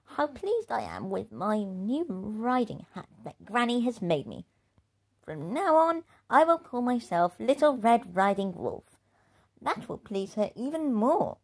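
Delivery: proud little wolf. Acted. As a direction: announce the new name with pride. Wolfie proud of new name.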